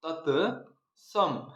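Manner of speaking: तत्सम drawn out in two parts, "tat... sam"; slow dictation. Shown as a demonstration. Teacher dictating the heading to students, तत्सम slowly split in two. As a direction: dictate तत्सम very slowly, pausing between syllables.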